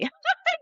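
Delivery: high giggle. Real, not acted. High laugh at how strange it is.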